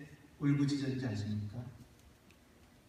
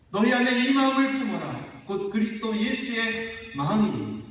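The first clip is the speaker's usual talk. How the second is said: proclaimed scripture; 6 semitones higher, 7 dB louder than usual. Acted. Preacher quotes scripture loudly.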